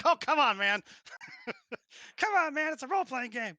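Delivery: loud, high mock exasperation; exasperated plea. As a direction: beg the player to play along, loud and high. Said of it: "I was like".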